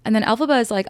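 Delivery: normal talking voice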